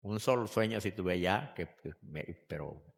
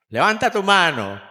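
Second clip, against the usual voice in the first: calling out; 8 semitones higher, 9 dB louder than usual. Real, not acted. Pide a la hija levantar mano.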